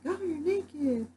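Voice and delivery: high, slow, drawn-out mock surprise; high slow mock shock; mock shocked gasp. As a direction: play a shocked onlooker, hammed-up. Mock quote of a shocked bystander.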